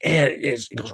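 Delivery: normal talking voice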